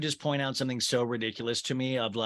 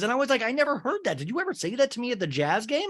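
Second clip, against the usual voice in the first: rushed mock disbelief; 9 semitones higher, faster than usual. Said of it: "She goes".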